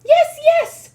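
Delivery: very high loud witch shout. Acted. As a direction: insist at the top of the voice. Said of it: "shouted the first witch".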